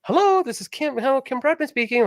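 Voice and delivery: high-pitched phone-answering voice; Kim. Retold or imitated. Mimicking wife answering at the bank, high work voice. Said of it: "she's both like".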